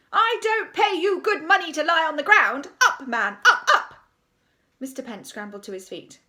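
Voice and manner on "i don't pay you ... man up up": loud, high commanding rebuke; angry scolding. A narrator's reading. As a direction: scold from a distance, ending in sharp orders. Headmistress scolds from window.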